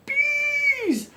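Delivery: high, drawn-out cry of sudden alarm; hammed-up shriek of realization. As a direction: shoot way up high and stretch it out. Sudden horrified realization of bees.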